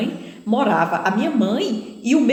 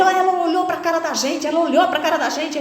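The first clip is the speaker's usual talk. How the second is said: angry robber; 7 semitones higher than usual. Retold or imitated. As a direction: accuse her of looking. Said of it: "ele falava". (bandido acusando, agudo e alto)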